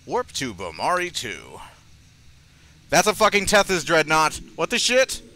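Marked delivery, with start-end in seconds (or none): 2.9-5.2 loud and higher, stunned; shocked outburst. Real, not acted. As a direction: blurt it in alarm. Shocked at a huge enemy ship.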